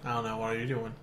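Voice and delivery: low, hushed sultry purr; sultry narrator. Pretend. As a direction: drop low and seductive. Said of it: "this sultry, like, voice".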